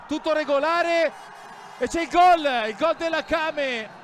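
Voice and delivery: loud and high goal shout; excited goal call. Real, not acted. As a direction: yell out the goal call live. Third goal called live.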